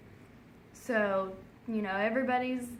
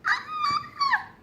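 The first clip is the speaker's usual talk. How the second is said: high, loud mock little-girl shriek; hammed-up high scream; girlish scream; 9 dB louder than usual. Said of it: "screams like a little girl".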